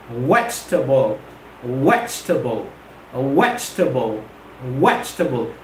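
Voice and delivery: "Vegetable" as low, falling VEJ-tuh-bul, pauses between; three-syllable vegetable. Shown as a demonstration. Teacher drilling students, "Vegetable" as vej-tuh-bul. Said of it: "it's vegetable".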